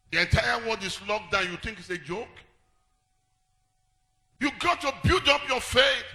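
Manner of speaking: loud, rising sermon voice over music; full-force preaching. Acted. Pastor preaching to the congregation, fervent and loud.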